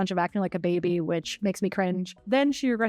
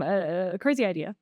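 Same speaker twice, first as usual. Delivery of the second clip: ordinary delivery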